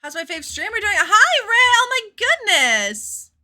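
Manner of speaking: chirpy fan voice greeting the streamer; gushing fan. Acted. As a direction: squeal it like an eager fan. Reading a fan's greeting in voice.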